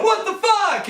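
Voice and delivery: high-pitched mock cry of alarm; imitated outraged surprise. Twenty bros finding their lawn on fire, high mock alarm.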